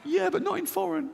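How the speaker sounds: high-pitched paranoid insistence; irrational inner voice. Acted. Irrational side insists to the rational one in a high voice. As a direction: pipe up in a small high voice. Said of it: "This one goes".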